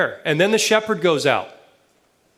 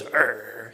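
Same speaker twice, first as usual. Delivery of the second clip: low, soft growl back; imitated dog growl; 5 semitones lower, 6 dB quieter than usual. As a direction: answer with a dog's growl. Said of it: "and he goes".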